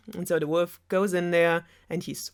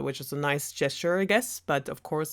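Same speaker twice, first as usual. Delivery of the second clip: usual speaking voice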